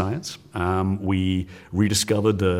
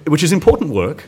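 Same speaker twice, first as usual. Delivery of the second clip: defensive; 6 semitones higher, 5 dB louder than usual. Real, not acted. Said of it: "You don't have to justify it".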